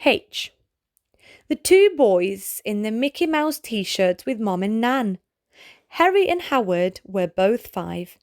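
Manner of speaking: ordinary delivery, nothing marked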